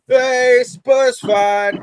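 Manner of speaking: loud mock greeting shouted across; calling out. Locals in Sunderland greet the Spurs fan, loudly calling out. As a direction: hail him like a cheery local.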